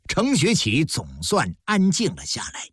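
plain reading voice

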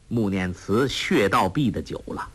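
ordinary delivery

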